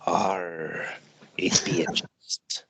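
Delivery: whispered pirate mutter to himself; slow, hushed pirate growl; hobbit pirate. Acted. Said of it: "Hobbit pirate".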